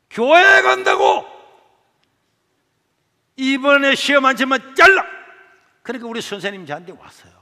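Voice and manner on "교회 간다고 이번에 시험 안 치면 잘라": slow, high, loud yell; furious shout. Retold or imitated. Teacher yells at the boy over skipping for church, shouting. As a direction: bellow it out in disbelief.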